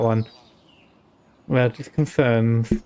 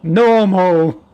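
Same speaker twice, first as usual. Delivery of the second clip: mock voice of the viewer; 2 semitones higher, 5 dB louder than usual. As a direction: shout it in a teasing copy of a friend. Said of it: "I'll make her sound like you".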